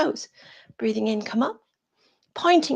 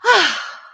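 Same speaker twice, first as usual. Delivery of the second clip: high, loud "ah" falling away; release sigh; 9 semitones higher, 7 dB louder than usual. Real, not acted. Yoga teacher finishing a shake-out, sighing loudly. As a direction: let go in a big voiced sigh. Sighs after shaking out.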